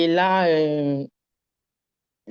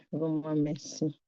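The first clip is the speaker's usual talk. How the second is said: "vraiment merci" dropped much softer, almost to herself; much softer repeat of "vraiment merci"; soft echoed thanks; 8 dB quieter than usual. Real, not acted. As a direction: let the repeat fade into a soft hush.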